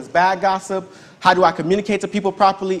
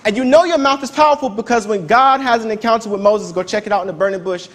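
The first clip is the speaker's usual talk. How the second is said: ordinary delivery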